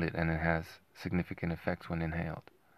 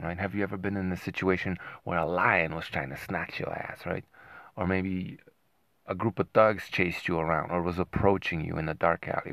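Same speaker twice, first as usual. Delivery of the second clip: usual speaking voice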